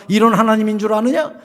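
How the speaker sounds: normal talking voice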